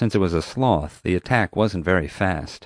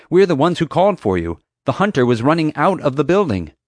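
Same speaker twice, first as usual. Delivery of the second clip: high, loud, urgent explanation; raised voice, pressing the point; exasperated protest; 8 semitones higher, 6 dB louder than usual. A narrator's reading. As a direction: insist to a mistaken guard. Protesting to an armed guard.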